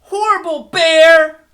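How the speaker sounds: loud, high furious yell; angry shout. Acted. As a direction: shout it out at the bear. Girl stomping and shouting.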